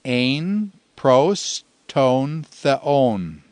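very slow, evenly spaced Greek words; slow recitation. Shown as a demonstration. Teacher giving students the second Greek line, slowly recited. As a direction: set each word apart for learners. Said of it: "The second line is".

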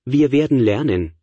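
normal talking voice

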